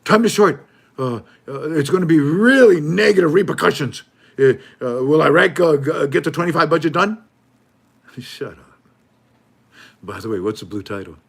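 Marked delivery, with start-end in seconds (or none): 0.0-7.2 high, loud hammed-up news shout; mock alarmist anchor. Mocking the troublemaking news outlet, a high loud alarmist shout. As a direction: shout the headline like a fearmonger. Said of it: "and you say".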